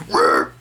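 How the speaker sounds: very low straining growl; deep bull roar. Shown as a demonstration. Urging viewers to be stubborn, low straining roar. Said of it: "You gotta go".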